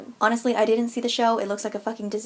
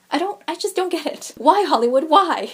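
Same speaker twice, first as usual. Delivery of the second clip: loud, pleading why at Hollywood; exasperated outcry; 7 semitones higher, 7 dB louder than usual. Real, not acted. Fed-up vlogger calling out Hollywood, loud exasperated cry. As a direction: throw it up to the sky, fed up.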